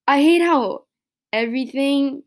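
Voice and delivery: loud, high burst of frustration; really pissed off. Real, not acted. Podcaster emotional about wanting a simple life, frustrated outburst. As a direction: push the words out, upset and fed up. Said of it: "really pissed off by this".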